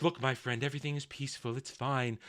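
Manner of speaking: low, hushed soothing deceiver; Sauron. Retold or imitated. The deceiver calming Celebrimbor inside the illusion, soft mock reassurance. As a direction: lull him softly, voice dropped. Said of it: "Here comes Sauron and says".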